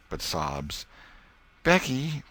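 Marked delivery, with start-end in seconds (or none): 1.6-2.2 pleadingly. A narrator's reading. Tom pleading with sobbing Becky, gentle plea. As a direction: beg her gently to answer.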